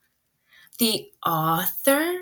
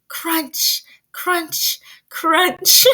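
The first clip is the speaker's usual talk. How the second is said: high playful crunching noise; teacher showing the crunch again; crunch sound; 6 semitones higher, 4 dB louder, much slower than usual. Shown as a demonstration. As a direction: make the snow crunch sound. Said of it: "it makes a crunching sound".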